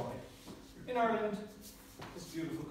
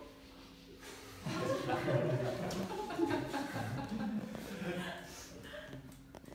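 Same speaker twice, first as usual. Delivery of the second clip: long laugh; 10 semitones higher than usual. Real, not acted. Laughs at drinking son joke.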